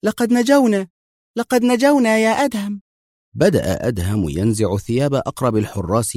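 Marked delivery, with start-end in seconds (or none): 0.0-2.8 high, thrilled exclamation; excited cry. A narrator's reading. Mona to Adham after escaping, excited cry. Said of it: "وهتفت منى في انفعال".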